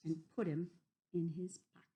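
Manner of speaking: usual speaking voice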